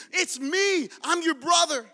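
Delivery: high, loud, insistent reveal; insistent cry. Acted. Joseph to brothers who doubt him, insistent cry.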